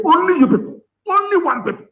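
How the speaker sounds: very high, indignant exclamation; appalled, pitch shooting up; exasperated outcry. Real, not acted. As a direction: cry it out in disbelief. Exasperated at one people's infighting.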